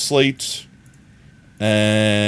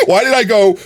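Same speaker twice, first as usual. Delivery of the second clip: usual speaking voice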